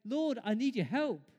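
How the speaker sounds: loud, high plea; crying out to God. Acted. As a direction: appeal loudly for help. Voicing people crying to God.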